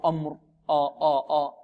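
أمر with a thick, heavy hamza, the wrong way. Shown as a demonstration. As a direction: fatten the آ in أمر as a mistake. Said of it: "نفخم الهمزة. خطأ".

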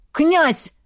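high, loud call to wake him; urgent shout. Acted. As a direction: shout his title to snap him out of it. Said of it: "Голос Береслава промелькнул".

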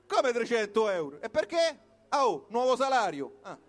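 ordinary delivery

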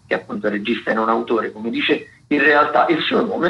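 normal talking voice

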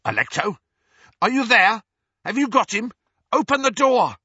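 high, loud calling through a door. A narrator's reading. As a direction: yell for his sister through the door.

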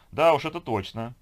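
high, loud, strained agreement; forced smile. Acted. Beauty agreeing about her workaholic man, forcedly cheerful. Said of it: "заставила себя улыбнуться красавица".

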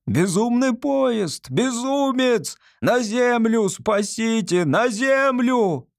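slow, high, drawn-out panicked screams; terrified crowd scream. Acted. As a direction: howl it out in sheer terror. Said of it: "жуткий тысячеголосый крик". (жуткий панический крик)

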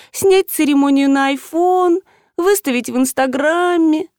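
slow, high, wistful lament; тоскливо протяжно. A narrator's reading. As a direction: drag it out mournfully. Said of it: "тоскливо протянула Марина". (тоскливо растягивая слова)

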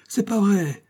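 the plea rising toward a cry; sobbing plea. Acted. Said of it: "Il suppliait et sanglotait".

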